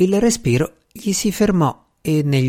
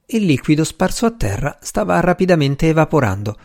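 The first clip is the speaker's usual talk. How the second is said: ordinary delivery, nothing marked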